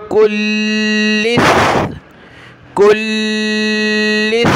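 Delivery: कुल्लिस with a long held l and th ending; chanted कुल्लिस, drawn-out l into th; doubled laam, saa. Shown as a demonstration. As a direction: lean on the ल्ल of कुल्लिस, tongue out at the end. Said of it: "लाम को सख्त किया सा को सख्त किया".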